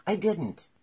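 loud, high protest; hurried interruption. A narrator's reading. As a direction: cut in with an urgent denial. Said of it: "interrupted in a great hurry".

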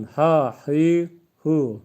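"ha, hi, hu" pushed from the throat, loud and apart; throaty ha hi hu. Shown as a demonstration. Teacher loudly shows students the throat sounds. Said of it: "Özellikle mahreçli olarak okuyorum".

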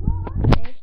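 high, loud call across the house. Acted. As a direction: shout it so everyone hears. Calling for missing players.

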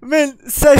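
loud, high, laughing. Real, not acted. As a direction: crack up calling out.

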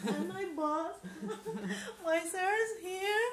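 overwhelmed as she introduces her boss; crying with joy. Real, not acted. Introducing her boss on camera, crying and laughing.

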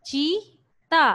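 चीता said very slowly with a long ई; teacher stretching चीता to show its long vowel; drawn-out word. Shown as a demonstration. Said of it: "अब चीता को देखिए".

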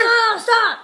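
high, loud yell; exasperated shout. Acted. Parent shouting at Manolo to stop, exasperated. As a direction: shout over the screaming. Acted parent yelling over scream.

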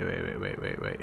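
rapid whispered waits. Real, not acted. Suddenly recognising a place.

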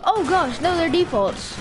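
high, loud alarm; panicked yelp at the screen. Real, not acted. Panicked in the game.

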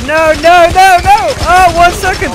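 loud high panic; panicked shouting. Real, not acted. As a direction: yell in real panic. Clock running out, panicking.

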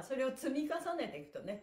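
ordinary talk, nothing marked

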